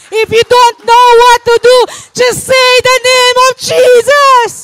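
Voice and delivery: high, loud preaching, slowing on the name of Jesus; fiery pastor proclaiming. Acted. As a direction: proclaim it to the congregation. Preaching testimony at full force.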